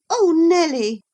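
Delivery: high, loud petulant complaint; a spoiled girl's loud whine. Acted. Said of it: "she added petulantly".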